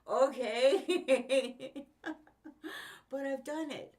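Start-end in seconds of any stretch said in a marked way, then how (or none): 0.1-1.8 a big, loud, laughing okay; loud resigned okay. Retold or imitated. Told sink or swim at every job, loud laughing okay. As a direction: throw up your hands and bellow it. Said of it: "I'm like".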